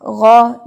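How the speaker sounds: गौ thick and loud from the throat; thick ghain. Shown as a demonstration. Teacher asks students to say ghain thick. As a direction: make गौ heavy, not thin. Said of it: "गईन मोटा करें".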